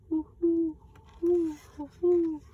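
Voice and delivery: high loud hooting like an owl; Pa hoots to find owls, high loud owl call; great horned owl call. Acted. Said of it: "Again he called out".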